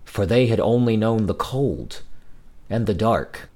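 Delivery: plain reading voice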